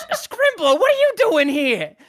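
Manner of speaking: high, loud goblin outcry; startled goblins. Acted. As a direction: shout in surprise as a goblin. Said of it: "then they go".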